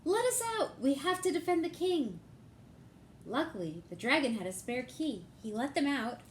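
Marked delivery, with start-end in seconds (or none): 0.0-2.2 much higher, urgent shout to be let out; calling to be freed. Acted. Knights locked in the tower calling out, high and urgent. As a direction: bang on the door and call.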